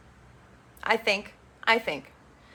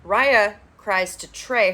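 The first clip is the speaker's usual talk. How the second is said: normal talking voice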